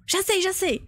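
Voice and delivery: imitating someone who has just got it, high-pitched; eager realization. Man runs up to her car, eager realization.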